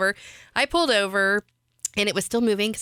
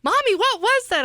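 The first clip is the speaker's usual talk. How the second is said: put-on little girl voice; 14 semitones higher than usual. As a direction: play the young daughter. Daughter asks from back seat.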